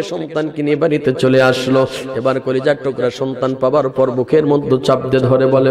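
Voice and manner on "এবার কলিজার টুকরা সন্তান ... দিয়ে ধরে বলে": ordinary talk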